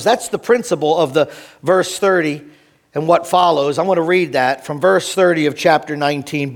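normal talking voice

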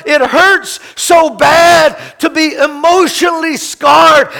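loud, high, pained shout; shouted anguish. Acted. Pastor voicing Jesus's imagined cry, shouted anguish. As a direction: shout the pain out. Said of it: "He doesn't cry out".

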